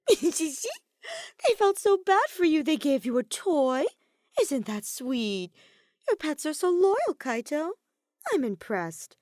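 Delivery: high, loud, slow sarcastic sweetness; mocking. A narrator's reading. Kokichi mocks Kaito's friends, high sarcastic sweetness. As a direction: drip fake praise on him.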